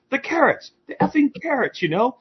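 very high exasperated British voice; squeaky hammed-up fury over carrots; mock angry Brit. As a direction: ham up the outraged Brit, fast at the end. Retold British friend swearing.

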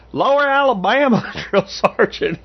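slow, high Southern recruit answering in a drawl; Yates's Southern drawl. Retold or imitated. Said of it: "He goes".

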